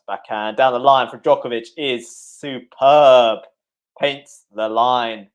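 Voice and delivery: loud, stretched-out commentary climax; excited winner call. Real, not acted. Commentator calling Djokovic's winner down the line, loud and drawn out. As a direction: build to a loud, drawn-out "superb", then slowly savour the finish. Commentator calls a winning shot.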